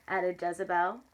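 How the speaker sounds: ordinary delivery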